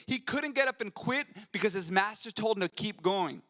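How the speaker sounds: high, loud, driving preacher's cadence; preaching at full force. Acted. Pastor urging his congregation to endure like Paul, preaching at full force. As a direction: build the congregation up, every line a hammer blow. Preaching about Paul's suffering.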